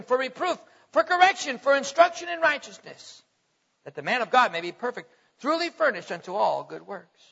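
rising preacher's reading of the verse; preaching the Word to the congregation; scripture reading. Acted. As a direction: read it out, building then settling.

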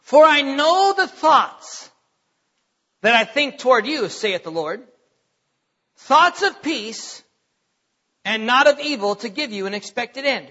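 slow, measured and solemn, every phrase weighed; slow scripture reading. Shown as a demonstration. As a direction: read the verse slowly as if for the first time. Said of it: "Listen to this".

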